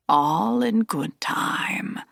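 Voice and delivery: slow rasping whisper; slow hoarse whisper of an old master; whispering old Aslan. Acted. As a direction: breathe it out, slow and secretive.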